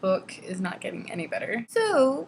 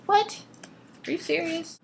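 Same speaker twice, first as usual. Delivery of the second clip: high, punched mock disbelief; can't believe what was just read; incredulous; 9 semitones higher than usual. Reader reacting to the overfriendly mom, incredulous.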